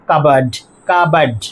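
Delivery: কাবার্ড as "cub-erd", no p; correct pronunciation. Shown as a demonstration. Teacher closing the drill, correct pronunciation. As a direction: silent p and weak ending in কাবার্ড. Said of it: "উচ্চারণ হচ্ছে".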